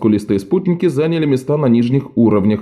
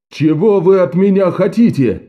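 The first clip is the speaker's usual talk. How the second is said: higher bellowed demand; roaring; 8 semitones higher than usual. A narrator's reading. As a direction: roar it up at the stands. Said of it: "взревел он".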